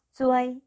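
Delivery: "쭈웨이" high and level; pronunciation demo. Shown as a demonstration. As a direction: glide through "쭈웨이" on one high note. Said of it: "쭈웨이라고 읽으시면 됩니다". ("쭈웨이"를 높고 평평하게 읽는 발음 시범)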